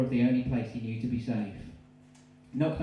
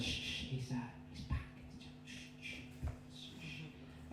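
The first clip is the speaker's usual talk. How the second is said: unvoiced, hushed shushing; whisper; 15 dB quieter than usual. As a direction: hush the lover so he won't hear. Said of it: "Mary replied".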